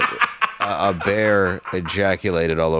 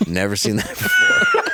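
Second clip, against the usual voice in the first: a much higher, laughing yelp of the same line; laughing shout. Retold or imitated. Echoing the cashier for friends, with a laughing shout. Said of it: "And she's like".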